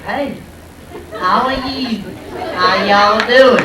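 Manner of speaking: mock Southern hello, slowed right down; Southern greeting. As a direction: stretch out how y'all doing like a friendly Southerner. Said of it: "people would greet me like".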